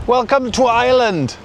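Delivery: high-pitched mock Irish welcome drifting into Scottish; botched Irish accent, sounding Scottish. Vlogger welcomes viewers to windy Northern Ireland, mock Irish-Scottish accent. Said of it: "I think that was a Scottish accent".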